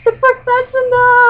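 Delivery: very high, loud, stretched-out fan cry; over-excited fan outburst. Retold or imitated. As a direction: imitate your own star-struck shriek. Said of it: "I was way too excited about it".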